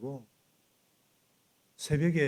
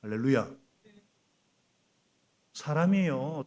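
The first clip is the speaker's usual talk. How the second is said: usual speaking voice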